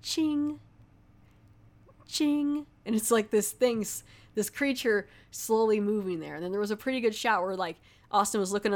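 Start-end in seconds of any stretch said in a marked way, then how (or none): 0.0-2.7 high imitated little bell chime, ching; bell sound. Imitating the corpse's toe bell in a creepy scene, high chime. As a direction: chime it like a small copper bell. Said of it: "all of a sudden you hear, like".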